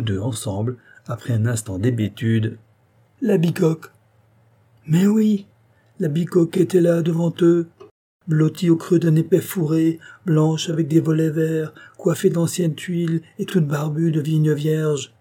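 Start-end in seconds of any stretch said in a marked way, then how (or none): 3.2-3.9 excited cry, pitched up; gasped, overjoyed discovery; joint exclamation. A narrator's reading. Both cry out on seeing the cottage. 4.9-13.2 raised, louder, eager read; breathless wonder at the cottage; excited narration. A narrator's reading. Narrator shares the joy of discovery.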